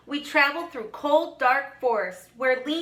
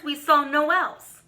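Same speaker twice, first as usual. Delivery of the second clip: ordinary delivery, nothing marked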